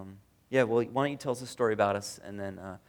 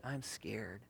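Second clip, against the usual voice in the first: quiet admission of fear; 5 semitones lower, 13 dB quieter than usual. Retold or imitated. Naming her fear quietly.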